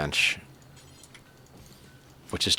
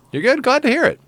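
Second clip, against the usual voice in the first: much higher, bright sing-song reply; high chirpy reply; 5 dB louder, faster than usual. Real, not acted. Answering a chatter, much higher sing-song. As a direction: chirp it way up to chat.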